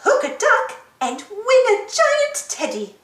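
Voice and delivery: high, bright-voiced stall keeper calling out; Miss Rabbit calling. Stall keeper Miss Rabbit calls out to the fair crowd, in a high calling voice. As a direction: call the customers over like a fairground rabbit.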